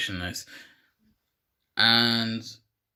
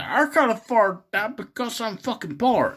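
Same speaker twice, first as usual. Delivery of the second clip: lightly voiced cartoon character, pitched up; put-on voice; 8 semitones higher, 4 dB louder than usual. Retelling his answer about the car repair bill, higher put-on voice. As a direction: raise it into a mock protest. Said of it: "he's like".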